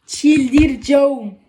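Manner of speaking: loud frustrated groan of a word; drawn-out exasperated wail; exasperated cry. Real, not acted. Exasperated over cars not fitting.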